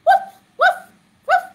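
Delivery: high, short woofs; dog barking. Shown as a demonstration. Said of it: "make a sound like a dog".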